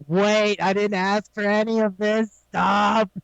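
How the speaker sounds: restrained retold yell of protest; drawn-out, mildly hammed-up yelling; mock yelling. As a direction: imitate someone yelling for it all to stop, without full volume. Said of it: "He's just yelling the entire time".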